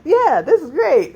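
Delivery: high, loud eager gush; mock eager. Jumper eagerly agreeing before the jump. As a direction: sound naively thrilled.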